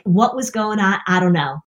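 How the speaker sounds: ordinary delivery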